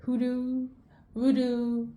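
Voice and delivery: ordinary talk, nothing marked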